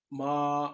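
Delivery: माँ held level and even, flat first tone. Shown as a demonstration. Teacher demonstrating माँ in the flat first tone. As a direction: sing माँ on one steady level pitch. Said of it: "first tone में माँ देखेंगे तो ये देखिए".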